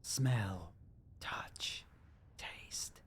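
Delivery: whispered ethereal echo of the game voice; disembodied voice. Retold or imitated. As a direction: breathe each word like a spirit. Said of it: "Disembodied voice".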